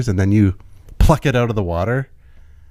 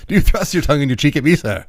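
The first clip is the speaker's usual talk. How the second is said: quick, higher mock-indignant challenge; indignant gentleman; 4 semitones higher than usual. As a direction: demand it like an affronted old-time gentleman. Acted challenge echoing the old novel.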